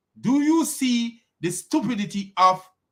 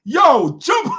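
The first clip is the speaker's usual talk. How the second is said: loud, high Jamaican shout; Jamaican accent; 5 semitones higher, 3 dB louder than usual. Acted. Host voicing a Jamaican telling someone to jump, Jamaican accent. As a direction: burst out high in Jamaican, near laughing. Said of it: "Jamaican be like".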